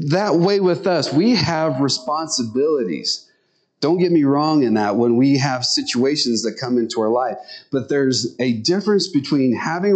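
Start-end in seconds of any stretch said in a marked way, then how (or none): none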